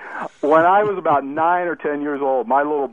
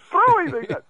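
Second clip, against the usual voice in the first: much higher child's whimper, mock crying; imitating crying child. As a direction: play the scared little brother, squeaky and pleading.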